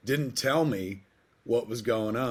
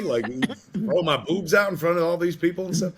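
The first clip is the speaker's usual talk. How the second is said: higher, laughing comic indignation; laughing protest in a put-on voice; mock outrage; 7 semitones higher, 5 dB louder than usual. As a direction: play it as hammed-up embarrassment. Protesting jersey being lifted.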